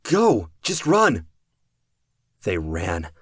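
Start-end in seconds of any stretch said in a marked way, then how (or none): 0.0-1.3 high loud yell; urgent shout. Acted. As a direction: yell at them to run. Kraus orders flight.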